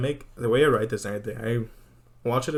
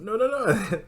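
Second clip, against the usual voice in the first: high, laughing protest through giggles; giggling disbelief, way up high; 11 semitones higher, slower than usual. Retold or imitated. Friend reacts in disbelief.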